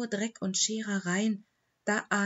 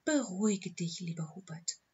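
calm him tenderly, voice dropping away; soothing; 3 dB quieter than usual. A narrator's reading. Dog comforting her weeping owner, soothing and soft.

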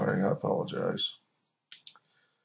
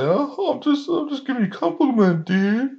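high-pitched put-on dopey excuse; mocking their excuse; 13 semitones higher than usual. T T S A excusing backhanded compliments, high mocking voice. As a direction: imitate them protesting innocence, pitched way up. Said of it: "that's when they say".

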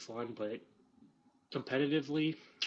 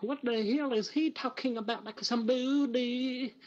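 high, loud goofy voice; mocking voice; 10 semitones higher, 4 dB louder, slower than usual. Mocking the listener's puzzled reaction, high goofy voice. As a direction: mimic the listener, hammed-up. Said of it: "You're over here like".

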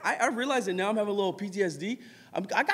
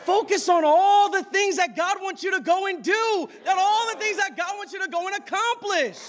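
high, loud exhortation; high-pitched, forceful sermon shout; impassioned preaching; 13 semitones higher, 5 dB louder than usual. Real, not acted. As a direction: urge the congregation on at full force.

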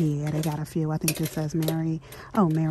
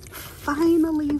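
high, slow, joyful cry; elated; 10 semitones higher, 3 dB louder, slower than usual. Real, not acted. Finding the wanted sign at last, high and elated. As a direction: savour the relief of finding it.

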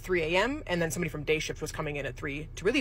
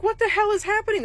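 much higher, louder mock bewilderment; baffled disbelief; 5 dB louder than usual. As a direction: squeak it out in total confusion. Baffled reaction amid chaos.

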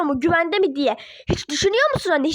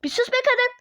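higher rough snap; angry hush; 5 semitones higher than usual. Acted. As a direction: cut her off sharply. Parent silences mother.

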